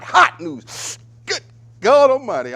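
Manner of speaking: high, loud, excited outburst; hyped exclamation. Real, not acted. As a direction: exclaim like a fired-up showman. Host exclaims over hot news.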